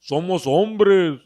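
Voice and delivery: loud, drawn-out, fake manly boom; mock a cousin forcing a big man's voice; put-on deep voice. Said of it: "he made his voice deep".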